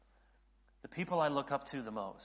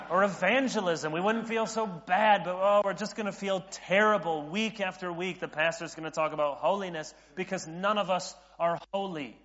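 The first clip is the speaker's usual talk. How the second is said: loud, high, put-upon griping; mock congregant complaint; 5 semitones higher, 7 dB louder than usual. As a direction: whine in protest as a dreading churchgoer. Acted congregant complaining about sermon series.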